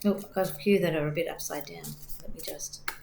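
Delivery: hoarse, worn-out voice; losing voice. Real, not acted. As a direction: speak with a tired, fading voice. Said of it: "I'm losing my voice as well".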